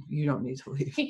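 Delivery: said through a laugh. Retold or imitated. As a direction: laugh while saying it. Bouncers laughing at her.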